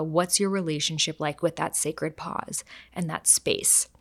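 ordinary talk, nothing marked

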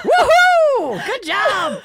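very high, loud mock celebration; hammed-up cheer. Comic acting out his fake finish, over-the-top whooping cheer. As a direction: cheer like you're faking wild delight. Hamming up faked climax as celebration.

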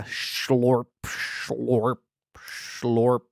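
slow, drawn-out squelch; imitated slime noise, stretched long; slimy slurping sound. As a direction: ooze each word out like moving slime. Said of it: "now there's a new sound".

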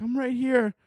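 small high voice; meek sadness. Acted. Sadness answering the mobsters, meek and small.

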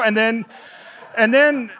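usual speaking voice

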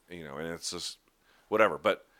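normal talking voice

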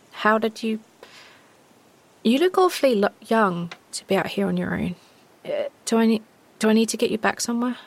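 lightly voiced girl, stammering starts; uncertain, concerned girl. Girl checks on a young stranger, haltingly concerned. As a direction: feel your way tentatively through each question.

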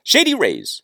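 usual speaking voice

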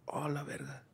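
breathy stunned whisper; whispered exclamation. Retold or imitated. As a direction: whisper it in awe. Imitating her own astonished reaction.

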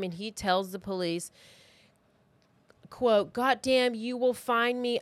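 ordinary delivery

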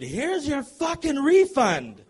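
high, loud and slow angry yell; furious yell. Retold or imitated. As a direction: spit it out slowly, furious and loud. Furious refund throw.

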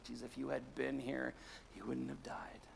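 ordinary delivery

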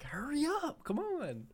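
high, whiny hurry-up; whiny impatient voice. Retold or imitated. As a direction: nag him in a squeaky voice. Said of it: "I'm like".

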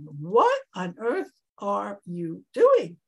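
ordinary delivery

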